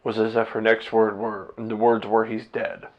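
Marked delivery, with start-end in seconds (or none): 2.3-2.9 soft, falling, as if breaking bad news; a quiet hint of the wife's dread; hushed dread. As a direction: let the words drop quietly, like the worst news. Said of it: "That's exactly how she said it".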